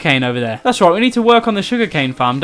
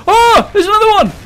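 panicked; 5 dB louder than usual. Real, not acted. Another monster appears.

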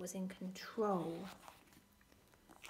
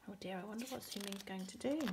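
plain reading voice

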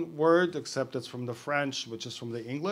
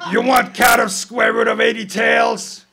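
loud, higher shout with a laugh in it; 10 semitones higher, 14 dB louder than usual. Acted. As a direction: bellow the joke up the mast. Captain shouting up the mast.

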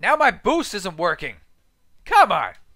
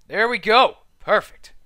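usual speaking voice

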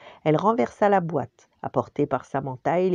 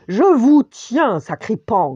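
slow, much louder, higher giant's snarl; giant growl; 7 semitones higher, 11 dB louder, slower than usual. Acted. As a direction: growl as the giant grabs them. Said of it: "grogna le géant".

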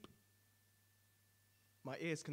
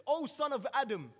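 slow, much higher and louder proclamation; deafening angel voice; 9 semitones higher, 12 dB louder than usual. Acted. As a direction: draw out the call like a verdict from heaven. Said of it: "responds in a deafening tone".

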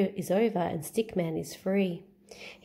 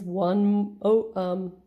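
louder jolly Santa; 4 dB louder than usual. Acted. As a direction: count it off cheerfully. Santa counting chimneys.

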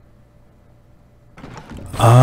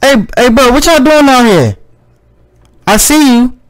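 calling out; 11 semitones higher than usual. Real, not acted. Player hails figures outside, high calling voice. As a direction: shout over to them.

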